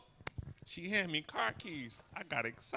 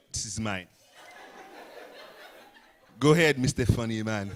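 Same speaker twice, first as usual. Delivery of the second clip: lower, threatening mock wife; mock possessive wife; 6 semitones lower, 7 dB louder than usual. Comic impersonates a woman guarding her husband, low and menacing. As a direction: claim your man and warn him off. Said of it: "that's what she".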